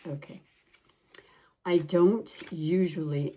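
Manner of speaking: talking while chewing gum. Real, not acted. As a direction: chew gum as you talk. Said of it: "I'm trying to talk and chew gum".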